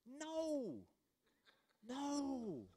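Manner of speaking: high, soft, long-drawn no; drawn-out mock dismay. Preacher answering a mistaken believer, high soft dismay. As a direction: croon a long, high no, gently falling away.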